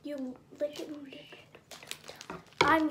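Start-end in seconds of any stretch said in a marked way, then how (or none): 0.0-1.2 whispering. Real, not acted. Whispering aside while tasting.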